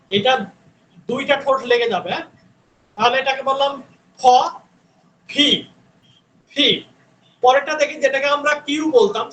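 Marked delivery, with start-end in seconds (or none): 4.2-6.7 ফি drilled with a puff of air; breathy lip release on ফি; aspirated p. Shown as a demonstration. Teacher repeating the aspirated P for the class. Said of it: "aspirated sound বলা হয়".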